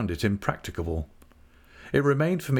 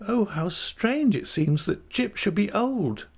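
astonished; 11 semitones higher than usual. A narrator's reading. Dora still astonished at news.

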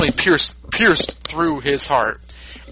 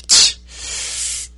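hissed start, then a high yell; spiteful outburst; much slower than usual. Retold or imitated. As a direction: spit it out in final spite. Frieza's final spite.

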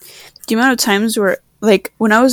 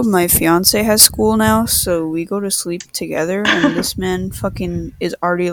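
ordinary delivery

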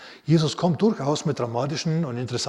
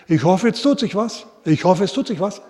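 pitched up and loud, nervously hoping; anxious pleading prayer; 6 semitones higher, 7 dB louder than usual. Retold or imitated. Jesus doubting at the tomb, mock anxious plea. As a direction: beg like you doubt it will work. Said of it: "Er sagt nicht".

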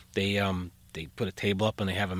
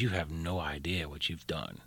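low, hushed reproach; quiet retold anger; 5 semitones lower, 9 dB quieter than usual. Electrician scolds over the fuse box, quietly angry. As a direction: imitate contained anger, softly and low. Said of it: "crazy angry".

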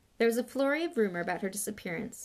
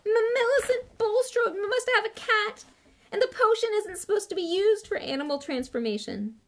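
loud high-pitched tearful wail; stammer, then wail the whole complaint; acted distraught cry, easing slightly; howling; 14 semitones higher, 7 dB louder than usual. A narrator's reading. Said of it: "she howled".

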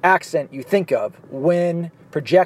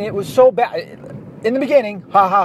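normal talking voice

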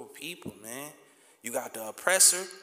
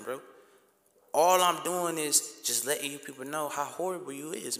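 usual speaking voice

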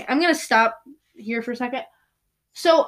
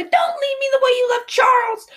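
high, loud desperate shout at her father; 5 dB louder than usual. Acted. Meg shouting at her father not to leave her, a high frantic yell. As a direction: hurl it at him in terror. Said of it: "Meg shouted at her father".

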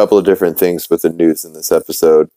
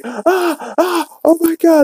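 high, mock-panicked yelp; cartoon dad screaming at the fence post in him; cry of shock; slower than usual. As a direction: squeal like something just went through you. Peter impaled by fence post.

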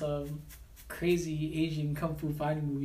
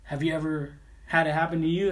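normal talking voice

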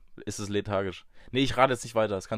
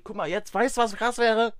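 much higher and louder, suddenly thrilled; excited idea; 11 semitones higher, 7 dB louder than usual. Real, not acted. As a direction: blurt out a great idea. (plötzlich begeistert)

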